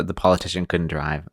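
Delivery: ordinary delivery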